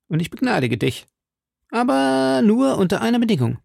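mock the sum, then grant mercy with a catch; young D'Artagnan, mocking. A narrator's reading.

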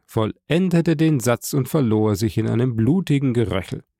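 ordinary delivery

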